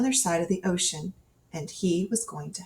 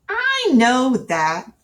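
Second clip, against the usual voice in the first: put-on slow, higher, louder indignant child; 6 semitones higher, 7 dB louder, much slower than usual. Child Cole to the narrator, slow indignant insistence. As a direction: insist you already knew.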